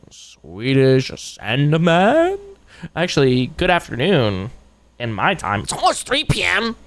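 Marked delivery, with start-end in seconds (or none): 0.1-2.4 drawn out. Real, not acted. Drawn out viewer name.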